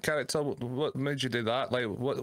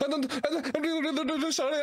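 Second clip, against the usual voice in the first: much higher, fast stammered apology breaking into a laugh; stuttering apology. Retold or imitated. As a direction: stammer out a panicked sorry, pitched way up. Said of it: "stop stuttering".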